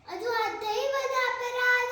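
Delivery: loud, high cry with a long-held last word; anguished outcry. Acted. Sita blames fate for the offence, loud anguished outcry. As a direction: cry out in protest, sustaining the final word.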